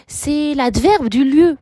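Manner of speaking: ordinary delivery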